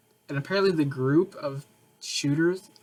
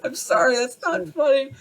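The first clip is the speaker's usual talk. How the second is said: laughing apology; 11 semitones higher, 4 dB louder than usual. Real, not acted.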